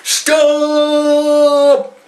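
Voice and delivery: high, loud, stretched cry to stop; desperate yell. Retold or imitated. Tumbling down the cliff, a loud high stop yell. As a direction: belt it out as you tumble. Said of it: "And I'm like".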